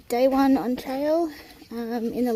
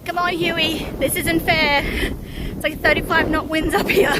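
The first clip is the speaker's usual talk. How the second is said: loud, raised voice against the gale; shouting over wind; 5 semitones higher, 7 dB louder than usual. Real, not acted. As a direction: call out over roaring wind. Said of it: "If you can hear me over the wind".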